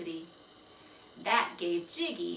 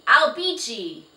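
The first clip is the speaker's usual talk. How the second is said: high, very loud threatening yell; angry yell; 4 semitones higher, 16 dB louder than usual. Acted. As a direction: bellow the threat at the horse. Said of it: "yelled Jiggy".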